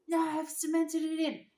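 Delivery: high, loud stubborn rebuttal; dad yelling back. Retold or imitated. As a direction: holler the denial over a storm. Dad shouting back over wind.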